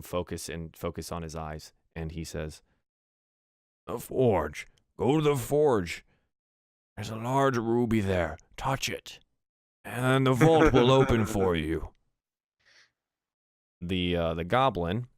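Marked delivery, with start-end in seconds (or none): 3.9-11.9 a hint of a pained prisoner; lightly voiced dwarf. Tortured dwarf telling the goblin, lightly voiced. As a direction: give up the secret, strained.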